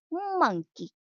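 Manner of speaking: "Monkey" pitched high and falling; model word. Shown as a demonstration. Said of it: "One more time".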